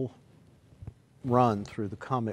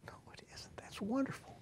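breathy soft reassurance to himself; whispered aside; 13 semitones higher, 14 dB quieter than usual. Real, not acted. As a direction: drop to a whisper. Whispered self answer.

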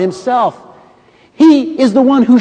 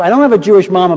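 ordinary talk

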